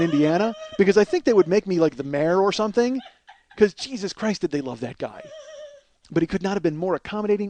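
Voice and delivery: loud, high, fast excited rant; building comic outburst. Real, not acted. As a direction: get worked up and run away with it. Excited rant about preferring Chamber.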